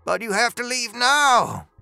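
high, urgent protest; urgent high-pitched cry, stretched at the end. A narrator's reading. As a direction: plead loudly in a high, agitated voice. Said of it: "Dooley cried".

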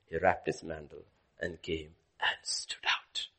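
slow storyteller's whisper. A narrator's reading. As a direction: hush the room as he steps out. Hushed retelling of Elijah.

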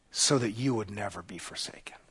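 soft, intimate whisper. Real, not acted. Preacher to the congregation after the cry from the cross, a tender whisper. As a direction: lean in and whisper the promise.

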